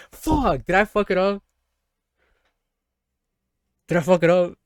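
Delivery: high, loud, alarmed cry; panicked alarm. Real, not acted. Streamer fearing the choice ruined the game, panicked. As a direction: panic that you broke it.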